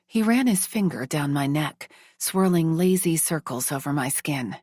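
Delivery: usual speaking voice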